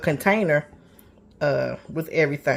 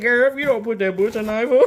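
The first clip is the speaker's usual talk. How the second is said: imitating a friend; 6 semitones higher, 5 dB louder than usual. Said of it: "Somebody said".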